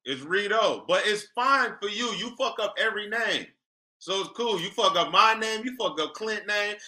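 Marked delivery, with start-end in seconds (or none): none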